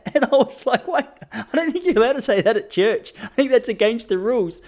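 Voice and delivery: amused mock scolding through laughs; laughing. Real, not acted. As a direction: say it while still laughing.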